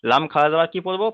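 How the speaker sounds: ordinary talk, nothing marked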